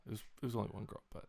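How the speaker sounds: ordinary talk, nothing marked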